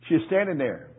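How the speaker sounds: ordinary talk, nothing marked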